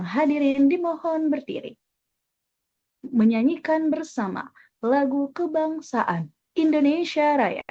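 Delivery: formal MC announcement at a moderate pace; medium tempo. Shown as a demonstration. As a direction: ask the audience to stand, evenly paced. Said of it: "Biasanya menggunakan tempo yang sedang".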